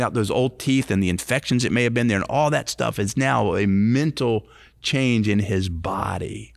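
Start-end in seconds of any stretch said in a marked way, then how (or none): none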